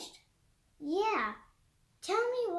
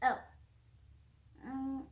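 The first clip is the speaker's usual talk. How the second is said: plain reading voice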